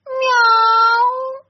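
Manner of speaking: high, loud meow; cat meow. A narrator's reading. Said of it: "кто-то сказал".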